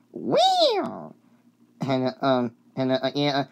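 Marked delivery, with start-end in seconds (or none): 0.1-1.1 high, sliding whistle noise; imitated train whistle. Shown as a demonstration. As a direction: toot like a little engine. Train noise inside the song.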